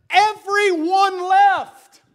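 high, slow, loud proclamation; dramatic reveal. Acted. Preacher telling the congregation everyone abandoned Jesus, high and slow. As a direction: stretch each word out high and loud.

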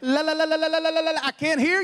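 high taunting singsong babble; mocking stammer. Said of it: "stammering and making fun of them".

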